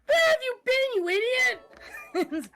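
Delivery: very high, loud mock shriek; shrieking relieved scolding. Owner spotting her lost chicken, high scolding shriek. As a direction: cry out at your runaway pet, voice way up.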